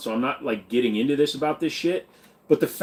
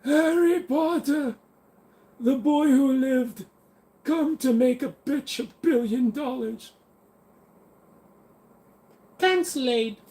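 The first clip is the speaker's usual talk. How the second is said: much higher, slow dramatic narrator; mock trailer voice; 10 semitones higher than usual. Streamer mocks Harry Potter in a high trailer voice. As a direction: announce it like a trailer.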